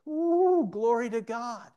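high, loud whoop into praise; whoop, then proclaim it joyfully; exultant praise. Real, not acted. Preacher praising God before congregation, exultant.

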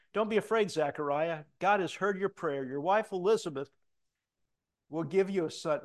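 the angel Gabriel reassuring Zechariah, booming. Acted. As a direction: announce it like a heavenly messenger. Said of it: "and I love this".

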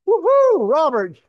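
very high, very loud whoop and name; calling out. Real, not acted. Preacher cheers Robert who just got wet, loud whooping call.

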